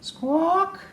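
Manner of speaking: high, sharp squawk; bird squawk. Acted. Morning racket outside Bruce's window, high squawk. Noisy animals wake Bruce.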